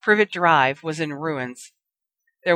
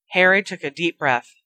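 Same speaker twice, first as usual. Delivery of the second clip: ordinary delivery, nothing marked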